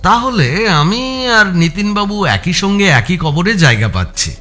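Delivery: ordinary delivery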